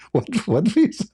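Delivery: high, baffled double take; retold disbelief. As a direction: act out being totally thrown by the news. Told he got the visa.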